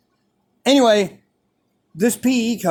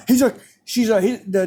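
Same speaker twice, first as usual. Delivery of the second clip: usual speaking voice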